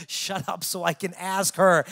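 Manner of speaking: loud, drawn-out mock yell; retold shout. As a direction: shout over a screaming crowd. Retelling yelling at screaming crowd.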